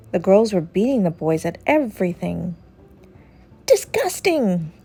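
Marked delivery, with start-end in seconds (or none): 3.7-4.7 high, drawn-out disgust. A narrator's reading. Rocky disgusted at losing to girls, high and slow. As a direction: spit out disgust, slowly.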